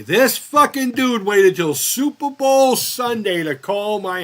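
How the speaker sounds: loud, high, drawn-out exasperation; indignant outburst. Real, not acted. Man telling of a doctor calling mid-game, indignant. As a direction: belt it out slowly in disbelief. Indignant complaint about a badly timed call.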